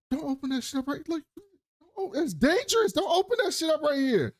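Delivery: very high, hammed-up alarm; panicky warning. [name] hands it over as dangerous, mock panicked. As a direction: plead in a squeaky mock panic.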